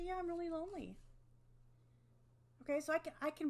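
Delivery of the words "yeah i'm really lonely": lonely statement. Shown as a demonstration. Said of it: "I say".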